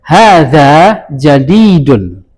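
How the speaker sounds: drawn-out slow Hadza jadidun; deliberate slow model phrase; slow teaching model. Shown as a demonstration. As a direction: spell out Hadza jadidun slowly for learners. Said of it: "sebenarnya khobarnya adalah ini".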